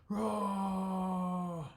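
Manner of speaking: high, loud drawn-out roar; monster roar. Acted. The bear roars at Bodhi, loud and long. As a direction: roar like a big cartoon bear.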